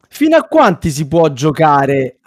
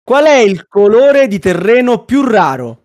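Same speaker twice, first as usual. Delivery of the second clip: showy game show host; 4 semitones higher than usual. Acted. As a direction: lively quiz host announcing a question.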